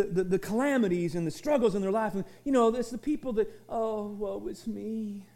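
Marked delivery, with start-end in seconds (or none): 3.7-5.2 mock woe. Mocking self-pitying whiner.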